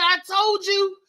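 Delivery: loud told-you-so; vindicated shout. Retold or imitated. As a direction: crow it at mother and grandmother.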